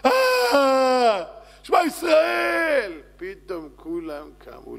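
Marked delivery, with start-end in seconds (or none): 0.1-3.0 long, high wailing shout; desperate cry to God. Acted. Man under pressure suddenly cries out to God, desperate drawn-out shout. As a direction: cry it out at full force, drawn out.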